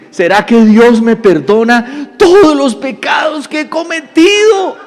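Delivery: slow, high, hammed-up crying question; mock tearful wail. Weeping man asking if God forgives him, exaggerated wail. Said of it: "empieza el hombre a llorar".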